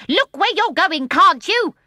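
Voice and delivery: high, loud angry shout; the lion shouts after a bump. A narrator's reading. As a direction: yell at whoever bumped you. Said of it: "he shouted".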